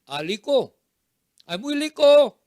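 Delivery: put-on child learning "rico" as "liko"; son copying mistake. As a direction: echo "rico" with L in place of R. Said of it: "Hijo, ¿cómo aprende?".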